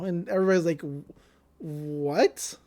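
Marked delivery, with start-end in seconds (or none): 1.6-2.6 drawn-out, questioning disbelief; confused. Retold or imitated. Club members hearing of a love letter, slowly puzzled. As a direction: act out slow bafflement. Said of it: "like, confused".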